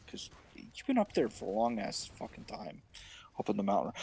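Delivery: evasive Aaron. Acted. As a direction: stall and hedge nervously.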